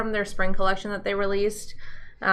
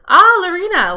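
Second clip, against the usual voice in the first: excited greeting; 9 semitones higher, 10 dB louder than usual. Real, not acted. Reacting to viewer's news.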